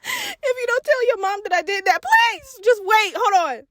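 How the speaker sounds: high, desperate wheedling; pleading bribe. Retold or imitated. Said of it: "I'm trying to bribe him".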